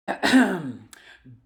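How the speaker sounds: loud theatrical throat clearing; throat clear for effect. A narrator's reading. Dad preparing to tell the story, showy throat clear. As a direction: clear the throat grandly before the tale. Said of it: "Dad cleared his throat for effect".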